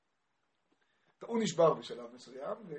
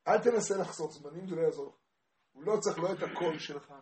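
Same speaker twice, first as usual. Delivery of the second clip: ordinary delivery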